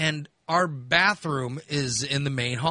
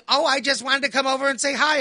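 breezy mother; 8 semitones higher than usual. Retold or imitated. Mother's innocent reply.

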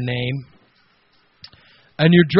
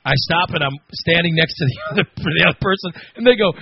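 ordinary talk, nothing marked

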